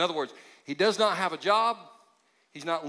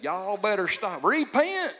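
put-on Southern accent; 5 semitones higher, slower than usual. Pastor demos his volunteered app voice, Southern accent. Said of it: "to do the Southern accent".

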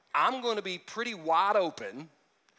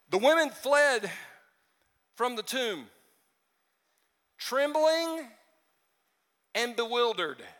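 scripture reading; 4 semitones higher than usual. Acted. Reading verse eight.

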